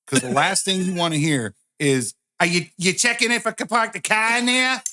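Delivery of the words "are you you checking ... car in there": high exaggerated Boston accent; hammed-up Boston doctor voice. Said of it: "would be a good gynecologist in Boston".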